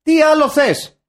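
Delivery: high, loud exasperated demand; mock outraged shout. Repeating the question to top the rant, mock outraged shout.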